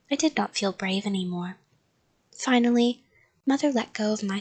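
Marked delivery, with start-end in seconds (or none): none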